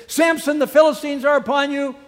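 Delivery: high, loud warning cry, fast; calling out alarm. Acted. Delilah calling to Samson that the Philistines have come, loud warning. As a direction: rush out the alarm.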